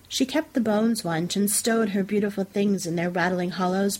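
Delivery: ordinary delivery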